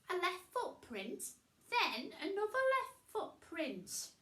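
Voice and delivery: high witch voice, thinking aloud; Winnie voice. A narrator's reading. Winnie puzzling over footprints.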